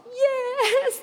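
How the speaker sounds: very high, drawn-out cry; acted squealed assent; overjoyed yes. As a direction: act out an emotional acceptance. Acts mother's long-awaited yes.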